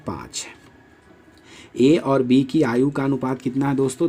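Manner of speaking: ordinary talk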